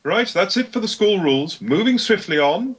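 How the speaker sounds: high, prim strict teacher; stern schoolmistress addressing a pupil; Gisela voice. Acted. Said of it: "I'll take, uh, Gisela".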